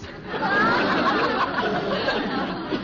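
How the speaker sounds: breathy laugh. Acted. Daughter laughs at her own joke.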